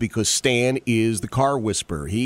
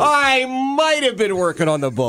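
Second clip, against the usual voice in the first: mocking echo; 12 semitones higher, 4 dB louder than usual. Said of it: "mocking Stan's admission".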